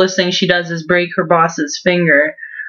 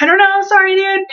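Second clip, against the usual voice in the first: hammed-up awkward panic, much higher; high squeaky panic; 12 semitones higher than usual. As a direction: squeak it out, totally overwhelmed. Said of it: "she was like".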